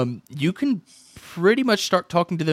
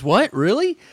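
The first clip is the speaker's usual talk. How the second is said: mock surprise; 8 semitones higher, 4 dB louder, slower than usual. Salesman surprised.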